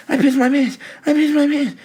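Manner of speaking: very high, squealing panic; shrill panic. Retold or imitated. Scared kid at home after wetting himself, squealing. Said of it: "And I'm just like".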